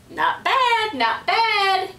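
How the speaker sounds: high chattering parrot echo. A narrator's reading. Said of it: "chattered Petey".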